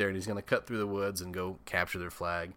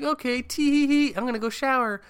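put-on very high, louder giggly girl voice; 5 dB louder than usual. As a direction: coo it like a ditzy teen. Eighteen-year-old Meg giggling.

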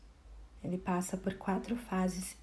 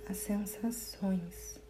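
guided meditation; 9 semitones higher, 4 dB quieter than usual. Acted. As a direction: guide the listener gently inward, phrase by phrase. (meditação guiada, voz pausada e tranquila)

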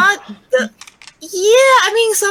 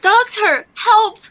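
high-pitched, panicked cry for the doctor; acted scream for help, not full volume; 10 semitones higher, slower than usual. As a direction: call out urgently, pitched well up. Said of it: "I run to the console room and screaming".

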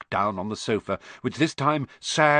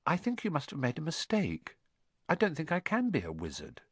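put-on softer, unsure boy's voice; quietly; 4 semitones higher, 7 dB quieter than usual. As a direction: say it hushed, doubting yourself. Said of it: "he said quietly".

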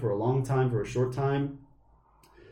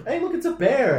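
mock Leo; 13 semitones higher, 5 dB louder than usual. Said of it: "Leo had such a".

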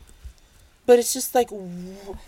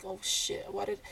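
unvoiced, hushed dismay; panicked whisper; 11 semitones higher, 13 dB quieter than usual. Vlogger whispers her panic after a risky comment. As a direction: breathe it out in sudden panic. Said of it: "It's just like".

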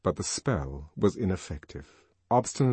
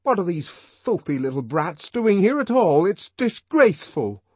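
loud, higher indignant retort; shaking with indignation; 10 semitones higher, 9 dB louder than usual. A narrator's reading. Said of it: "trembling with indignation".

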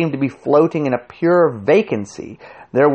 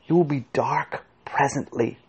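hushed, barely voiced warning; secretive, soft murmur; mouthed; 3 semitones higher, 6 dB quieter than usual. A narrator's reading. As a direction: mouth it softly, as if for a lip reader. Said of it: "he mouthed".

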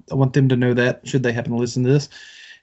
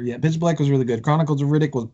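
ordinary talk, nothing marked

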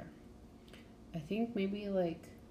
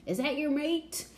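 high, loud, gleeful teasing; teasing brothers; 10 semitones higher, 8 dB louder than usual. Retold or imitated. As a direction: rib him like excited siblings. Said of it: "his brothers are like".

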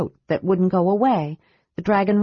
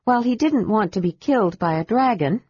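plain reading voice

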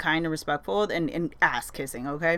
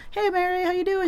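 much higher, sing-song greeting; sweet greeting; 12 semitones higher than usual. Retold or imitated. Greeting her friend Mary at the counter sweetly. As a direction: chirp it like a friendly regular. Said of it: "so I'm like".

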